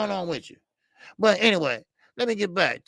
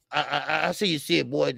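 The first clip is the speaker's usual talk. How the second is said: ordinary talk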